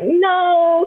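long, high-pitched no; comic high-voiced protest; mock squeamish refusal. Acted refusal to pushy men.

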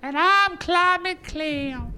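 high, slow cartoon clam; squeaky clam voice. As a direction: squeak it out slowly like a tiny clam. Character voice Climate Clam.

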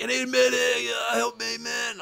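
high, strained mock plea; distant cry for help. Downed player calling for a medic in the distance, high wailing plea. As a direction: call out like a wounded soldier. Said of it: "in the distance I hear this".

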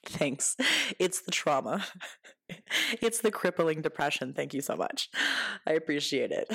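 darkly amused, laughing between phrases; mock gratitude with a laugh; deadpan joke breaking into laughter; sarcastic thanks. Sarcastic thanks for weight compliments.

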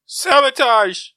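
booming drawn-out title call; dramatic shout. Pretend. Echoing the episode title, a slow booming shout. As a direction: shout it like a radio announcer.

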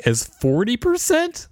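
shocked rising read of the figure; surprised. Real, not acted. Cohost reading a shocking suicide figure aloud, surprised. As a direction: voice leaps up in disbelief on percent.

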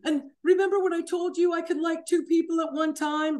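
mock tearful whining apology; fake crying. Imitating cheating partner begging forgiveness, fake crying. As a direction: imitate phony sobbing, high-pitched. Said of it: "he started fake crying".

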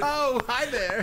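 slow, high put-on hello; mock sweet greeting. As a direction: coo it like a flirt.

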